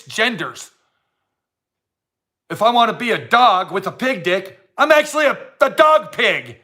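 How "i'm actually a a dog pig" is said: mock claim. Mocking made-up genders, silly proud claim. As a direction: play the silly claim for laughs.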